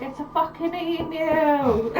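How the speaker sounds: put-on thick Scottish accent. Scottish guy asked about his emu, thick Scottish accent. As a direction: imitate a broad Scot, drawn out. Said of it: "in a really thick Scottish accent".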